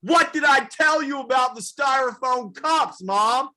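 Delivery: high, loud scolding; mock outraged shout. Comic scolding his mother in a high mock shout. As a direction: yell at mom over the cups. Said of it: "And I was like".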